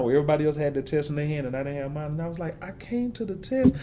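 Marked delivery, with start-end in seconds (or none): 2.6-3.7 much higher, slow, small choked-up voice; the confused student close to crying; near tears. Retold or imitated. Student retelling his missing test, voice near tears. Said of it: "I was about to cry in class".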